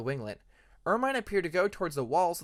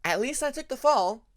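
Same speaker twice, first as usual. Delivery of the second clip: high, upbeat Hyena; 10 semitones higher, 6 dB louder than usual. A narrator's reading. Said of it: "Hyena pointed out".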